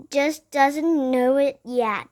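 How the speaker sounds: usual speaking voice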